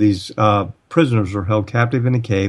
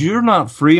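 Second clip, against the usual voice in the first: higher-pitched mock contradiction; imitated insistence; 7 semitones higher than usual. Man arguing with the speaker about freedom, imitated insistence. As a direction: mimic someone flatly refusing to accept it.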